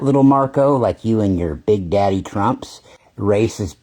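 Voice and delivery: ordinary talk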